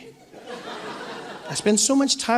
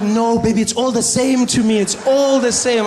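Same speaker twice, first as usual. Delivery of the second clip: high, louder, soothing mother; reassuring mom voice; 5 semitones higher, 6 dB louder than usual. Retold or imitated. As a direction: reassure him like a doting mom. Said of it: "She's like".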